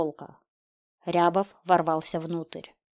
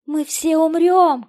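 high, loud, drawn-out wail; panicked scream; 11 semitones higher, 10 dB louder, slower than usual. A narrator's reading. Wife clutching her daughter, panicked high scream. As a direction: scream it in despair. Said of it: "кричала жена".

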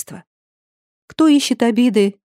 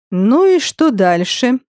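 slow, drawn-out taunt, raised in pitch; almost cheerful taunt; 5 semitones higher, 3 dB louder, slower than usual. A narrator's reading. Captor into the pinned girl's ear, almost cheerful taunt. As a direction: stretch it out with a touch of amused menace. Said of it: "выдохнул он ей в ухо. Вроде даже и весело".

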